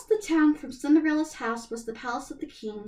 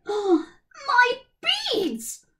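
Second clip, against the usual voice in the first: high, loud outraged cry; cried out; 13 semitones higher, 7 dB louder, much slower than usual. A narrator's reading. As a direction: shriek at the theft. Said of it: "cried one stepsister".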